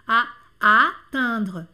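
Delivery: attendre with a spread smiling nasal, slowly. Shown as a demonstration. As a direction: smile through the nasal in attendre. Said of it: "nasal sorriso". (attendre com nasal sorrindo, devagar)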